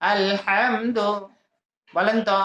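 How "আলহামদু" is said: "আলহামদু" very slowly, with a breathy throat h; throat ha drill. Shown as a demonstration. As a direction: split "আলহামদু" after আল, then draw out হাম from mid-throat. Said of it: "হা আছে না?". (টেনে টেনে, গলার মাঝখান থেকে হা দিয়ে "আলহামদু")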